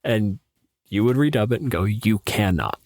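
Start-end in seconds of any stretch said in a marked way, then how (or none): none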